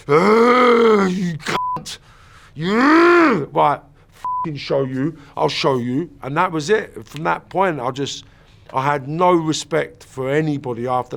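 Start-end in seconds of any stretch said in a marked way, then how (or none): 0.1-6.1 loud, choked outburst then slow menace; crying anger settling into a cold threat; bitter fury. Retold or imitated. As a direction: spit out a grudge swearing revenge. Child's rage after the burning.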